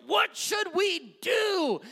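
high, drawn-out mock cry; convicted crowd pleading. Crowd asks Peter after feeling convicted, loud pleading wail. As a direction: wail it slowly as a stricken crowd. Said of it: "They feel the conviction".

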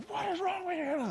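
drenched theologian snapping at the one who doused him, mock outrage; losing his temper. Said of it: "Loses his temper".

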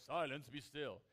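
lightly hammed-up divine command; mock God voice. Shown as a demonstration. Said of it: "not putting on his God voice".

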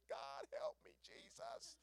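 hushed, squeaky mock-desperate plea; acted silent cry for help, soft and rising very high; desperate inner prayer. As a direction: pray in secret panic, straining high. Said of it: "But in my mind".

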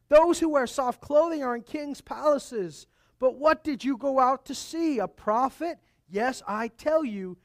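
loud, emphatic sermon reading; preaching scripture. Acted. Pastor reading Jesus' words to the crowd, preaching loudly. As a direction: proclaim each question to the crowd.

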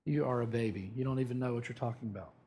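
low, soft mocking retort; a hushed eye-roll at a youngster; mock scoff. Older listeners scoffing at the pastor, low and soft. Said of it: "some of you are going".

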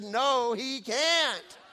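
loud, high mock protest; joking refusal. Father teasing his wife and son with a loud mock no. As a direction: bark it out as a playful joke. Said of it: "And I said".

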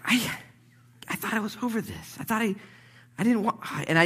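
high, halting whine of disappointment; mock distraught. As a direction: stammer it as if let down. Acts out being upset at own failure.

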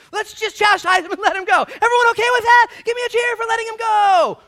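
very high, loud shout to a crowd; full-force high yelling; desperate appeal. Acted. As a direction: holler for the crowd's agreement. Pilate calls out to the crowd.

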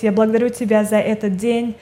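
usual speaking voice

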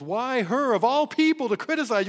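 loud, high "Why her of all people", pressing on "her"; preacher's emphatic rhetorical question. Shown as a demonstration. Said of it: "the her is moved forward in the Greek".

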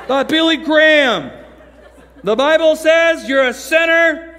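slow, high-pitched, booming recitation; oblivious loud reading. Retold or imitated. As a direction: read it far too loud, dragging out every phrase. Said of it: "didn't understand how loud he was talking".

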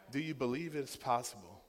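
ordinary talk

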